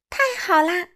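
high, loud cheer of delight; joyful cheer. A narrator's reading. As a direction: cheer like a thrilled little bat. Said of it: "莱尼高声欢呼". (高声欢呼)

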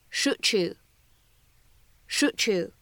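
"Shoot you" blended to "shoo-chu", t and y fused into ch; ch assimilation. Shown as a demonstration. Teacher showing the ch blend, "shoo-chu". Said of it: "类似于ch的发音".

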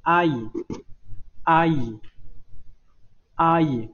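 teacher's clear 阿姨, again and again; repeat-after-me demo. Shown as a demonstration. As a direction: model 阿姨 for the class to echo. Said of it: "跟我读一下".

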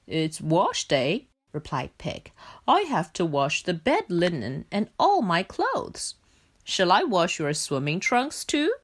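a practical pig on wash day; Pig's voice. Acted.

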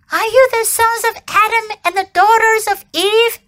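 high, eager beaver voice; lisping buck-toothed beaver. Acted. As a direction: play a small timid forest beaver. Beaver character voice.

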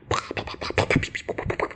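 fast breathy pa-pa-pa of flying fists; rapid punch noises. Retold or imitated. Storyteller imitating punches traded in a fight, fast and breathy. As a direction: rattle off the punches like a flurry of blows.

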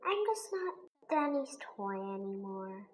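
very quiet, low, dejected; sad. A narrator's reading. Teddy B no longer Donnie's toy, sad and quiet. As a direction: sink into a soft, sorrowful murmur. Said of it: "he says sadly".

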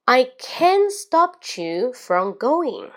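slow, linked, CAN'T high and GOING rising then falling; wave-like intonation. Shown as a demonstration. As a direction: roll "I can't stop you from going" up and down like waves. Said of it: "语音语调像波浪一样". (慢速连读，语调像波浪一样)

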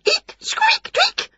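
very high tiny mouse squeaks; squeaky mouse voices. Acted. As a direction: squeak it like frightened mice. Mice caught squeaking.

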